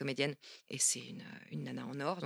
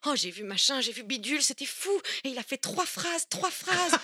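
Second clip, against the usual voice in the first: gushing fangirl; 7 semitones higher than usual. Retold or imitated. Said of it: "je disais à mon mec".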